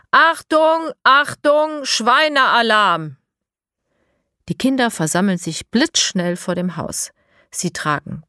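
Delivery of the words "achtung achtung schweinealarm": high loud alarm call through a megaphone. Acted. Eda calls the children with the megaphone, loud alarm call. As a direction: shout the alarm into the house. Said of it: "Eda ruft hinein".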